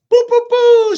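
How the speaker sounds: very high, loud "boo, boo, boo"; imitated celebratory shots. Streamer hails a viewer's donation with high, loud imitated shots. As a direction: fire off high vocal gun sounds. Imitated noise before a shout-out.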